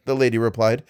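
usual speaking voice

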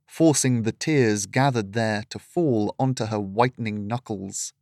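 usual speaking voice

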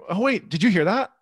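ordinary talk

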